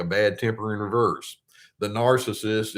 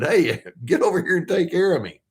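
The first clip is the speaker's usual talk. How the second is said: higher, slightly louder mock yell; mock newborn demand; 8 semitones higher, 4 dB louder than usual. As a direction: playfully call out like a demanding newborn. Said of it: "you started screaming and said".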